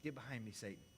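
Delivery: cold and low, not shouted; Jesus turning sternly on Peter; low stern rebuke. Acted. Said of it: "Powerful rebuke".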